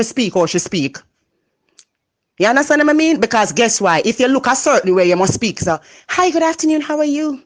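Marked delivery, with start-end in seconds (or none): 6.1-7.4 mock prim standard-English greeting, pitched high; proper polite voice. Mocking the accent society demands, prim polite greeting. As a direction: put on the polite voice society expects. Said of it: "You gotta sound like everybody else".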